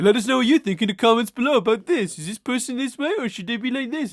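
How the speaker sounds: high, loud mock YouTuber outro; YouTuber sign-off imitation. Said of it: "YouTube videos end with them going".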